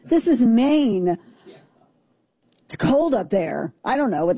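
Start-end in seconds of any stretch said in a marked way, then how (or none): 0.0-1.2 "this is Maine" drawn out slowly, hitting "is"; slow and incredulous, leaning on "is"; emphatic. Real, not acted. Stressing that it happened in Maine, not Mississippi. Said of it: "repeated for emphasis".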